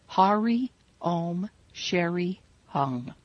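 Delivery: slow, careful Hari Om Sheri Hung, each syllable clear; chant-like teaching demo of "Hari Om Sheri Hung"; mantra pronunciation. Shown as a demonstration. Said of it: "listen to the pronunciation of the mantra".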